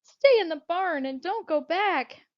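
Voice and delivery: high, loud, drawn-out plea; slow, urgent begging; fearful. Acted. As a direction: implore a friend, afraid for them. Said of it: "Bertha said fearfully".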